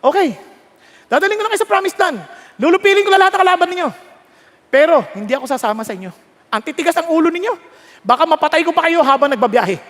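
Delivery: loud, fast scolding in God's role; high, fed-up acted ultimatum; exasperated God. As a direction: lay down terms sternly to stubborn people. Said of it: "Ano sinasabi ni God?".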